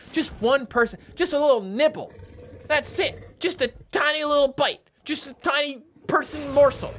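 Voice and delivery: put-on high, wheedling baby-talk plea; pleading coaxing. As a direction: coax a fussy eater into one bite. Coaxes dinosaur to eat someone.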